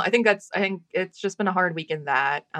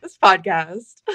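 slow, louder mock ad voice; mock announcer; 8 dB louder, slower than usual. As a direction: announce it like a cheesy radio sponsor. Mock sponsor tag for podcast.

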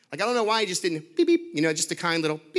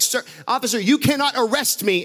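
belligerent protest; 6 semitones higher, 5 dB louder than usual. Retold or imitated. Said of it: "he's starting to say things like".

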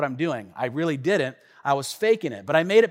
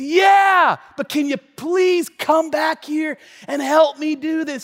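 high pleading; 9 semitones higher, 4 dB louder, slower than usual. Retold or imitated. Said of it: "I said".

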